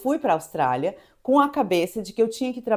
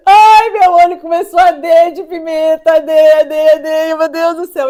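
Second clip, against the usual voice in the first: high, very loud mock crying; hammed-up panic at burning eyes; acted pain wail; 7 semitones higher, 14 dB louder than usual. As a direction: wail it out in comic agony.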